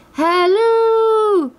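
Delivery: high, long-held holler; loud, drawn-out calling; shouted call. A narrator's reading. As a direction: shout it out with a stretched final note. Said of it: "he shouted to the left".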